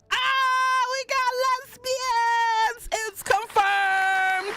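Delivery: very high, drawn-out squeal of excitement; elated squeal. Real, not acted. Player discovering the two women are lovers, elated squeal.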